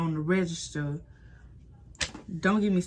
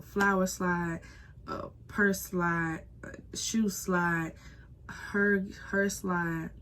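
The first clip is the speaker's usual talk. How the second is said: slow mocking list; much slower than usual. Real, not acted. Listing boss's silly demands.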